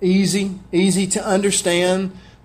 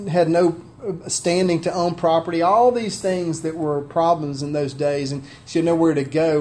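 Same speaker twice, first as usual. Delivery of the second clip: ordinary talk, nothing marked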